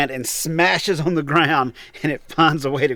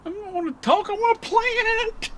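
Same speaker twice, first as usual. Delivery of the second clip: put-on whiny child voice; 4 dB quieter than usual. Refusing to chat with partner, just wants to plant, in a whiny kid voice. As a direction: sulk like a little kid who wants to play.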